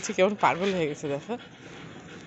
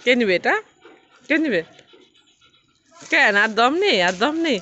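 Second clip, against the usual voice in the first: 1 playful challenge; 3 semitones higher, 6 dB louder than usual. Real, not acted. Challenging pet to play.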